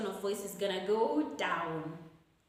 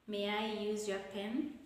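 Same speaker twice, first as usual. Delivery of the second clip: ordinary talk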